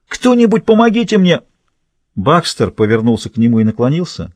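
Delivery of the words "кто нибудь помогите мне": high, desperate cry for help; pained plea. Acted. Blinded prisoner calls for anyone's help, pained plea. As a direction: beg for help at a raised pitch. Injured man begs for help.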